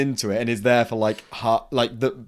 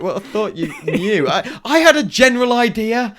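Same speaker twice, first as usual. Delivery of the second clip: laughing excuse; 10 semitones higher, 6 dB louder than usual. Retold or imitated. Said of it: "it's like".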